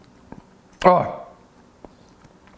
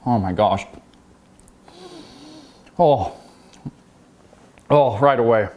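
ordinary talk

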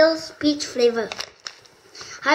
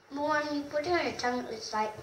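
chewing candy while talking; 10 dB quieter than usual. Real, not acted. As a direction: mouth partly full. Seen 1: hand puts candy to mouth, eats while talking 0.1–3.1.

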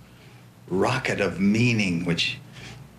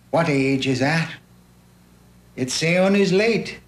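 high, lilting Irish recitation; raised, sing-song Irish storyteller; Irish accent; 5 semitones higher than usual. Acted. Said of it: "I'm doing it in an Irish accent".

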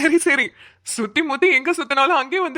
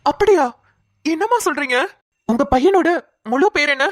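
higher, louder, startled questions; astonished; 5 semitones higher, 3 dB louder than usual. Acted. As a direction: ask in urgent surprise. Learns her lost son shares his name. (ஆச்சரியத்தோடு உயர்ந்த குரலில்)

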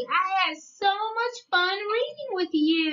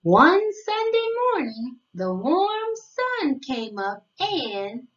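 storybook reading; 3 semitones lower than usual. Acted. Narrator reading a picture book to young children, sing-song storytelling. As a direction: read it like a bedtime picture book, savoring each day.

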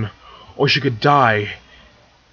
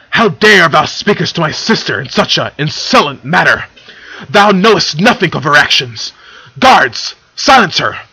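booming royal fury, loud and raised; angry shout; 4 semitones higher, 8 dB louder than usual. A narrator's reading. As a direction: thunder at the offender, commanding the guards. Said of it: "Luna shouted angrily".

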